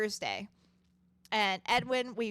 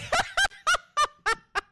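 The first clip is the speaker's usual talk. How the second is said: loud high laugh; 9 dB louder than usual. Real, not acted. Laughs at own joke.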